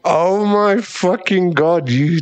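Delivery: high, slow, delighted shock; a loud, high, drawn-out gasp of glee; gleeful disbelief. Acted. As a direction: draw it out in mock amazement. Realizing they enjoy it.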